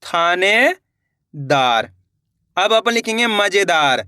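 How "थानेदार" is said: थानेदार drawn out very slowly, syllables joined; slow teacher blending; slow blended word. Shown as a demonstration. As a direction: stretch थानेदार out as the letters combine. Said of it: "तो मिलके बन जाएगा अपने".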